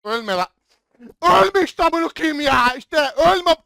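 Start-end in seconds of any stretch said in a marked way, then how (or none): 1.2-3.6 louder, higher, slowed desperate plea; desperate shout. Real, not acted. Gamer begging a teammate not to die, desperate shout. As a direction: yell it slowly, begging.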